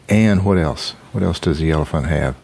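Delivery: usual speaking voice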